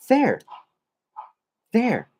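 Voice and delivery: "There" repeated high, clear falling; demo pronunciation. Shown as a demonstration. Teacher repeating "there" for students, clear demo. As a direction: model "There" twice for learners. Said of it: "it's also the same".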